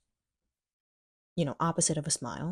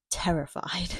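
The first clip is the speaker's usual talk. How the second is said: ordinary delivery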